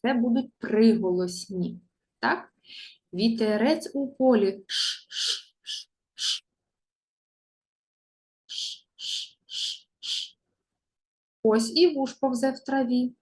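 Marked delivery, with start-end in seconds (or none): 4.7-10.4 soft breathy sh like wind in a field; long whispered sh sound; long shushing wind. Shown as a demonstration. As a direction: hiss a long quiet shh like a breeze. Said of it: "повторіть за мною звуки довгі".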